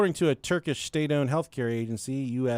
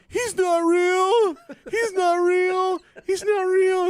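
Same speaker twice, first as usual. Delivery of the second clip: high, loud, drawn-out sob-shout; wailing child; 6 dB louder, slower than usual. Retold or imitated. Little sister wailing on the restaurant floor, high and loud. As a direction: wail it like a kid breaking down in public.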